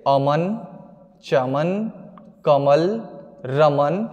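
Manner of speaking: अमन, चमन, कमल, रमन low and separate, each rising at the end; example word list. Shown as a demonstration. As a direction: read out each example word slowly, with a pause after it. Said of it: "अमात्रिक शब्द कहते हैं। जैसे". (उदाहरण शब्दों का नीचा, ठहरा हुआ पाठ)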